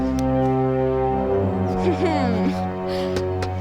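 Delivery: villain chuckle. Acted. Villain gloating.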